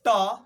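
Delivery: ट sharply struck from the roof of the mouth; retroflex ta. Shown as a demonstration. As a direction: say ट with the tongue tip curled back, loud and crisp. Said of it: "बोलिए तो थोड़ा". (मूर्धन्य ट, ज़ोर से)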